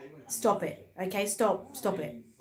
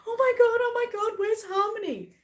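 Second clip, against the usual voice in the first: much higher, hammed-up panicked cry; mock panic; 13 semitones higher, 5 dB louder than usual. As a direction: imitate a friend suddenly missing her doll. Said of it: "all of a sudden she goes".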